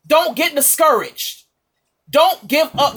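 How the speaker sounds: ordinary talk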